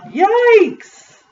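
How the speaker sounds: high-pitched alarmed yell. A narrator's reading. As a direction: yelp it high and drawn out.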